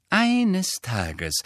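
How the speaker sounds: high, exasperated opening; thoroughly annoyed. A narrator's reading. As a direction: begin the lecture in exasperation. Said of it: "klang gründlich verärgert". (gründlich verärgert, hoch angesetzt)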